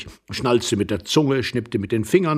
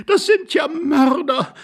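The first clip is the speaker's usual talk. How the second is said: slow, very high wail; high-pitched hysterical accusation; horrified lady; 4 dB louder, slower than usual. A narrator's reading. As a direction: draw it out in shrieking horror. Lady accuses intruders in horror.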